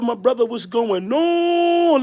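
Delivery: high greeting with a long held last word; excited greeting. Real, not acted. Streamer greets Brother Love on the live, excited greeting. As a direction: stretch the ending into a happy call.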